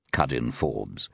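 usual speaking voice

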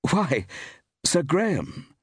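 high-pitched, startled greeting; surprised exclamation. A narrator's reading. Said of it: "ejaculated Steve".

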